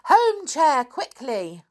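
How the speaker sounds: high, urgent command falling away; loud cry. Acted. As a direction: call the order out to the chair. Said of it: "cried out loudly".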